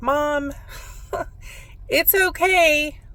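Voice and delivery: choked-up plea, breaking on okay; crying. Retold or imitated. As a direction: voice cracking high through tears. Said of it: "I was hysterically crying".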